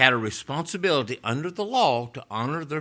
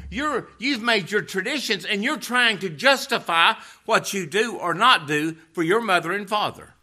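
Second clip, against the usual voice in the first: high-pitched, insistent pulpit delivery; impassioned preaching; 9 semitones higher, 6 dB louder than usual. Real, not acted. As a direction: challenge the congregation with rising urgency. Preaches at full force.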